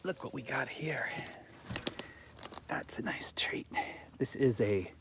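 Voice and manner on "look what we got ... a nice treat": whispering. Real, not acted. Finds berry, whispers to self.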